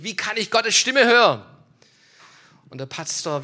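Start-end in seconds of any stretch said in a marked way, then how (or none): 0.0-1.4 higher, louder insistent question; imitated loud demand. Man standing beside the pastor, imitated loud demand.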